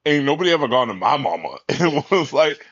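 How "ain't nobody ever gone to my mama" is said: normal talking voice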